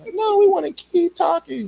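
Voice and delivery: high, loud imitation of others objecting; mock a loud, high-pitched refusal to leave; playfully imitating insistent talkers; mimicked protest.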